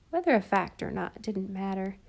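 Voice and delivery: usual speaking voice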